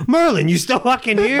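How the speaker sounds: high-pitched mock call-out; O'Leary heckling. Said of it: "O'Leary would be like".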